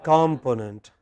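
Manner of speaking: "component" stressed on the first syllable, KOM-ponent; low "component" with front stress; mistaken Indian pronunciation. Shown as a demonstration. Teacher shows how many in India say it, wrong pronunciation. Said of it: "many of us in India pronounce it as".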